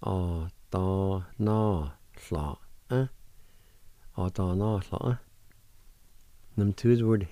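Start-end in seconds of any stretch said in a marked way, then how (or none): 0.0-3.1 "Á dá ná hla, eh" drawn out slowly; slow demonstration. Shown as a demonstration. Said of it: "now I'll pronounce these words". 4.2-5.2 "Á dá ná hla, eh" run together as one word; joined-up pronunciation. Shown as a demonstration. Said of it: "now I'll pronounce these words".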